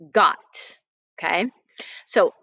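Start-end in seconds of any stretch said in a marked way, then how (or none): 0.1-0.4 "g", "ot", then blended "got"; sounding out got. Shown as a demonstration. Teacher sounding out "got" for the class. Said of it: "I'm gonna show you this word here".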